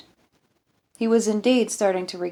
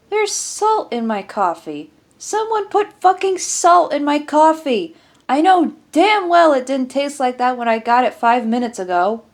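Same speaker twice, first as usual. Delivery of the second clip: furious shout; 8 semitones higher, 9 dB louder than usual. Acted. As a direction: explode at the whole table. Sergeant rages about salted coffee.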